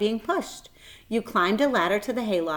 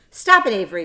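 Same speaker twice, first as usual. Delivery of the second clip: louder plea; crying out; 9 dB louder, faster than usual. A narrator's reading. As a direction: cry out to stop him. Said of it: "cried Fern".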